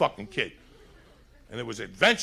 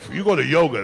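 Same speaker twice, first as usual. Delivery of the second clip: usual speaking voice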